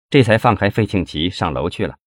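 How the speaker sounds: ordinary delivery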